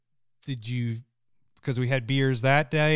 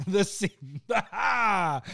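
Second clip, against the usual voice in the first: pirate accent slipping out; lingering pirate accent; 5 semitones higher, much slower than usual. Real, not acted. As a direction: still a trace of pirate accent. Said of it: "I still sound like a pirate there".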